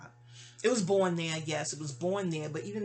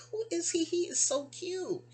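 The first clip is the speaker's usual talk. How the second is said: gushing; 11 semitones higher than usual. Retold or imitated. Gushing over the godson.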